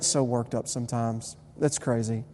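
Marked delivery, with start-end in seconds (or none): none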